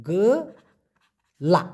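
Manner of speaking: গেলা drawn out very slowly; slow pronunciation. Shown as a demonstration. Teacher modelling the Malay word slowly for learners. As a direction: stretch গেলা out, every sound long. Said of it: "উচ্চারণটা কি হবে?".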